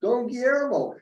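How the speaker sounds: imitated high-pitched shout of a name; yelled reply. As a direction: call back to an old friend from afar. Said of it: "And he yelled back".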